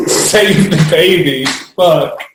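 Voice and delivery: can barely get it out for laughing. Real, not acted. Recalling friend saving baby from his fall, laughing. Laughing telling pram mishap.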